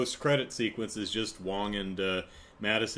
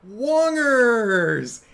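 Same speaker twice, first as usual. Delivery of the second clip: very high, loud, delighted shout; excited greeting; 11 dB louder than usual. Retold or imitated. Madison spotting Wong through the portal, delighted squeal. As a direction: squeal his nickname like you're overjoyed. She sees Wong and she goes.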